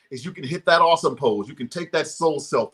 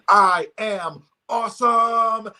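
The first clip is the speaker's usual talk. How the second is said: slow, drawn-out, higher "I am awesome"; a slow, raised anthem line; proclaiming the refrain; 7 semitones higher, 4 dB louder, much slower than usual. Shown as a demonstration. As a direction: declare "I am awesome" slowly, like an anthem. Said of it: "Whenever I said the refrain".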